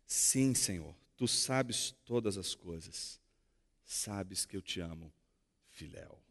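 sorrowful, almost whispered confession; saddened Peter. Acted. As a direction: let the hurt pull the voice down to a breath. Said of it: "Depois de se entristecer".

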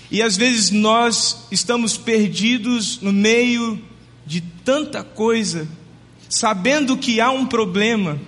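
pregação lenta e mais aguda, com pausas longas. Acted. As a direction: arraste as palavras como quem prega com peso. (pregador falando devagar à congregação, em tom solene)

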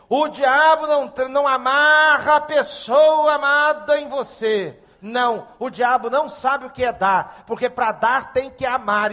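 loud, high, emphatic sermon delivery; forceful pulpit proclamation, stretched stressed words; preaching at full force. Real, not acted. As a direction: drive each point home to the congregation.

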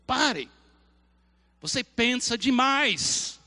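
stern God voice. Acted. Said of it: "A voz de Deus na sua vida".

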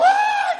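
very high mock shriek; re-enacted high-pitched scream; retold scream. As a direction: scream in comic terror. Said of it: "gritei de novo".

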